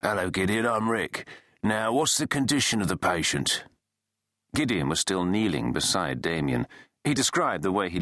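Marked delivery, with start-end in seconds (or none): 0.0-3.6 lightly voiced male paramedic; a hint of a brisk medic on the phone; masculine paramedic. A narrator's reading. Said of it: "a masculine voice said".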